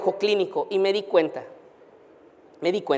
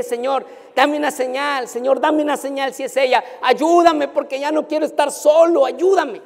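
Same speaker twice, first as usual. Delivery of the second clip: pleading prayer; 6 semitones higher, 3 dB louder than usual. Retold or imitated. Pastor begs God for wife.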